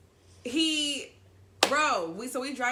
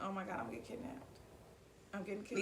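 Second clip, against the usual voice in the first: scared; 14 dB quieter, much faster than usual. Retold or imitated. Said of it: "I was so scared".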